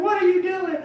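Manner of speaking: a lightly voiced frightened farmer. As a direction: play the scared landowner, hammed up. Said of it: "he's like".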